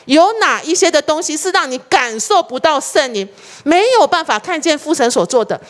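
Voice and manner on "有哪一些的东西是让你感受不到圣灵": ordinary talk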